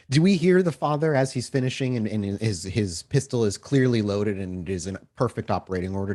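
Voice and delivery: normal talking voice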